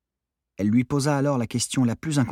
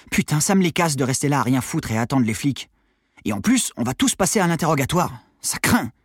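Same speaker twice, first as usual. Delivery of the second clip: put-on higher-pitched, animated teen complaint; fed-up teenage Armand; 6 semitones higher, 4 dB louder than usual. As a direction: rant like an impatient teenage boy. Teen hero rants to friend. (ado exaspéré qui râle)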